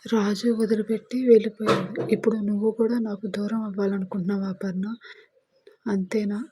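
usual speaking voice

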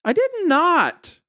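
put-on very high, stretched-out child's denial; high little-girl protest; protesting six-year-old. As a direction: protest like a small kid, slow and high. Twin denies it indignantly.

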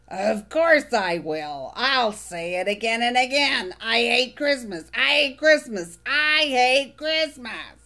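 gleefully sour, savor every complaint; Oscar grouch. Acted. Oscar voiced, grumpy.

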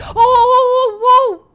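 high, loud panicked cry; startled fright. Real, not acted. Gamer jumped by something in the game, startled fright. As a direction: yelp out in sudden alarm. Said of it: "Oh, I'm scared".

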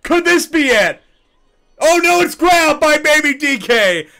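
high, loud excited call; excited play-by-play. Acted. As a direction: call the catch with rising excitement.

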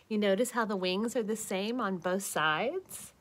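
plain reading voice

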